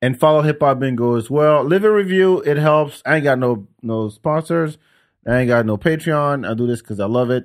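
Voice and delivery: ordinary talk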